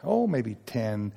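drawn out and low, "Oh, maybe ten." said slowly as a hedge; a drawn-out, low-key guess; slow hesitant estimate. Retold or imitated. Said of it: "Once you say that, they'll expand it".

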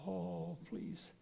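ordinary talk

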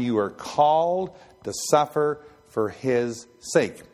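ordinary talk

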